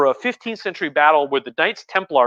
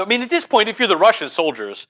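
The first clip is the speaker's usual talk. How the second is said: ordinary delivery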